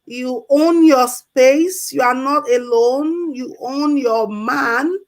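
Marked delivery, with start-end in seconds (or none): none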